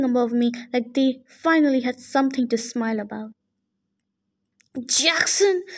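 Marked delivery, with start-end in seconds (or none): 4.9-5.6 angry yell. A narrator's reading. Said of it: "Luke yelled".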